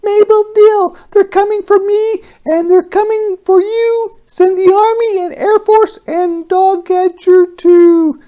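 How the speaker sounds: very high, loud, tiny alien voice; frantic high little alien yelling for help; squeaky alien broadcast. Acted. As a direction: shout the alarm in a high squeaky pitch.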